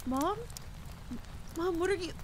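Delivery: high, startled questioning; alarmed confusion. Acted. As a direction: question your mother in high-pitched alarm. Confused child tackled by mother.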